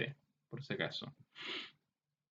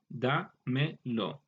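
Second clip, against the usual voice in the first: "dámelo" very slowly as da, me, lo; split into parts; much slower than usual. Shown as a demonstration. Teacher taking a compound apart for students, "dámelo" slowly piece by piece. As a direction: break "dámelo" into three separate pieces. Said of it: "al compuesto".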